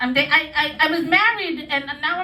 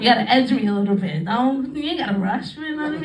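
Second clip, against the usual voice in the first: ordinary delivery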